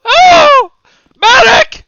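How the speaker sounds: screaming for a medic, high; man down shout. Acted. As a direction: yell for help in panic. Calling for a medic.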